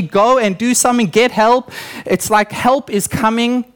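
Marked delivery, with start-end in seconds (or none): none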